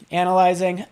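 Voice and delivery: ordinary delivery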